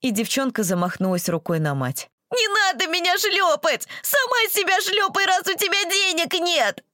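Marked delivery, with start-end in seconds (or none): 2.3-6.8 high tearful tantrum; crying child yelling. Acted. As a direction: wail back at mother. Tantrum at mother.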